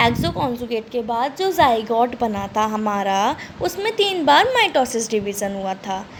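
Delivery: slow, deliberate teacher recap; slow recap for listening; very slowly. Shown as a demonstration. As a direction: spell it out slowly so students can follow with eyes closed. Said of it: "अब मैं आपको बहुत धीरे धीरे बोल रही हूँ".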